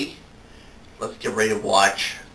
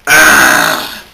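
startled yelp; 5 semitones higher, 13 dB louder than usual. Acted.

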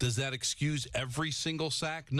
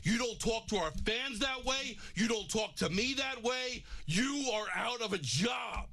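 team owner firing a player, shouting high; John Mara; 7 semitones higher than usual. Pretend. Owner calling the lineman into his office, furious firing speech. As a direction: lay down the law in a high angry shout. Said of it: "I call him into the office and say".

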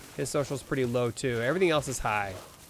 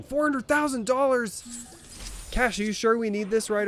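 shocked; 9 semitones higher than usual. Real, not acted. Shocked at the building price.

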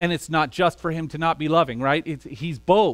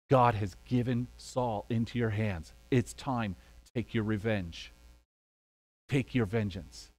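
hushed urging; 8 semitones lower, 8 dB quieter than usual. Retold or imitated. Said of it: "David's friend goes".

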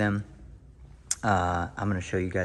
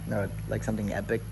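usual speaking voice

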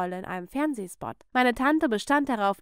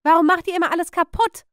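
loud, high, fast outburst; furious aunt, rapid and raised; angry scolding; 7 semitones higher, 8 dB louder, faster than usual. Acted. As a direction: scold the children at high pitch and full force. Aunt rages at the children.

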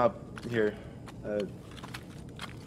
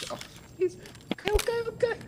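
high frantic okays; scared, squeaky okay; panicked pleading; 4 dB quieter, faster than usual. Real, not acted. Pleading at gunpoint.

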